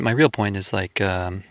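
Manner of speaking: usual speaking voice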